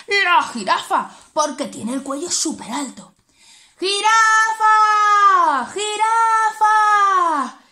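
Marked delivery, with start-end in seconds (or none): 3.8-7.6 grito más agudo y alargado; llámala a lo lejos, estirando; llamada a gritos. Acted. Llamando a la jirafa. (los animales llaman a la jirafa a gritos, alargando)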